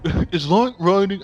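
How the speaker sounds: posh British. Shown as a demonstration. Repeating the film line in an exaggerated posh English accent. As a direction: stretch it out, terribly posh. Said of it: "I'm so fucking posh about it".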